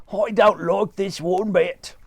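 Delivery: husband hating being upside down; dismayed Mister Twit. Acted. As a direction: grumble it unhappily.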